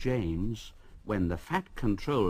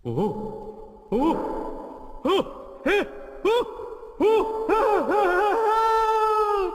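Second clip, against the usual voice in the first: rising, higher and higher scared cries; frightened shouts; 4 dB louder, much slower than usual. A narrator's reading. Workmen fleeing the tunnel, crying out in fright. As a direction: yelp in mounting fright. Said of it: "they all shouted".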